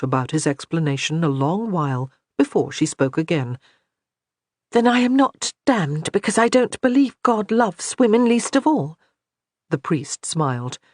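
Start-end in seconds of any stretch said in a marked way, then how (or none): 4.7-9.0 higher, lightly voiced young woman, hopeful; a girl asking if she's damned; young woman's voice. A narrator's reading. Johanna asking the priest, higher lightly voiced woman.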